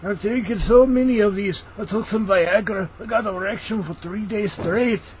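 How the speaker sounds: mock Scottish Shrek boasting; Shrek Scottish accent. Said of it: "I only do Shrek".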